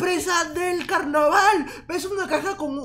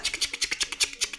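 maraca shaking noises; 7 dB quieter than usual. Pretend. Imitating maraca shakes.

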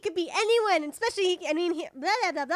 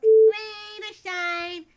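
大きく伸ばす台詞; 3 semitones higher, 6 dB louder, much slower than usual. Acted. Recording take, held loud line.

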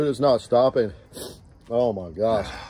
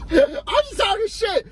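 laughing; slower than usual. Real, not acted. Laughing through the disclaimer.

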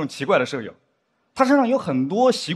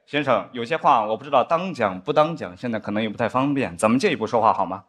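lightly voiced solemn spy line, lower; same mock conspirator hush; a touch of grave TV drama; spy drama dialogue again; 7 semitones lower than usual. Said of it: "他就应该更加主动一点".

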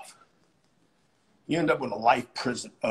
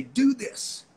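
firm command; 10 semitones higher than usual. Shown as a demonstration. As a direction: tell them straight. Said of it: "love somebody enough to scream at 'em".

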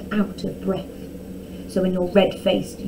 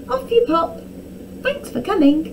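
high, sugary send-off; sweet dismissal; 9 semitones higher than usual. A narrator's reading. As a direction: shoo them away sweetly. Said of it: "Arabella said sweetly".

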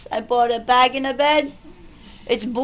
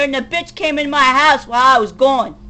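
ordinary talk

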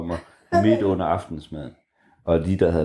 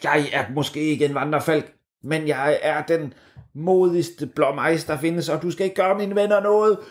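brave blue tit; 6 semitones higher than usual. A narrator's reading. The blue tit defies the cheese monster, high and bold. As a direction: puff up like a tiny brave bird. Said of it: "og så sagde den".

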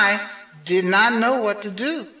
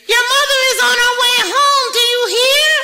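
panicked fish; 12 semitones higher, faster than usual. A narrator's reading. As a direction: gabble it out in a panic. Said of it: "said the fish".

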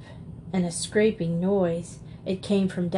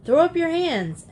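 high ringing shout of a mock hero; 6 semitones higher than usual. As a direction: bellow the surrender like a stage highwayman. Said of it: "shouting out".

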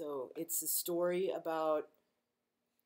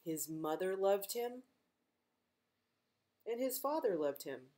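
gentle read-aloud storytime voice; reading to children. Acted. Librarian reading a picture book to kids, gently.

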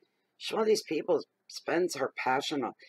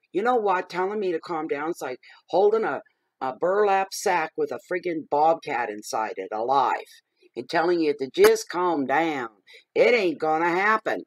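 louder, higher, worked-up rant; fired-up defiance, louder with each phrase; heated rant; 4 semitones higher, 7 dB louder than usual. Real, not acted. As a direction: build it up until you nearly shout. Ranting about being told calm.

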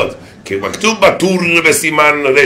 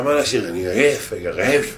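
soft tired husband; 2 semitones lower, 7 dB quieter than usual. Retold or imitated. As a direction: moan it like a worn-out husband. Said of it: "אומר לה".

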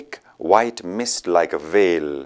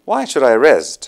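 bright, louder playful question; gaily; 5 semitones higher, 9 dB louder, slower than usual. Acted. Companion brushing off the need to rest, gaily. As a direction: toss it off with glee. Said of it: "asked gaily".

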